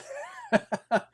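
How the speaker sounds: short high-pitched laugh; a quick amused laugh. Real, not acted. Laughs at constant talking.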